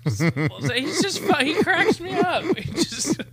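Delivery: high, giddy praise; delighted gushing. Real, not acted. Praising the comic to cohost, high and delighted. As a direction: gush about him, voice way up. Gushing over the Sinbad clip.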